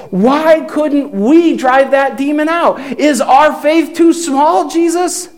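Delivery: a hint of frightened disciples begging for an answer; anxious plea. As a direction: plead up to the teacher in mild distress. Disciples anxiously ask Jesus.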